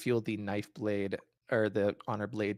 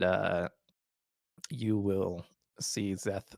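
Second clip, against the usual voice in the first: ordinary delivery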